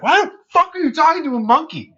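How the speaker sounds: high-pitched incredulous outburst; mock disbelief. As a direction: imitate bystanders totally baffled. Everybody's reaction, acted out.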